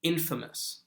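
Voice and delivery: "infamous" modeled as "IN-fuh-mus"; "infamous" with stressed IN and unstressed middle; correct pronunciation. Shown as a demonstration. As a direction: hit the first syllable of "infamous", swallow the A. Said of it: "it's infamous".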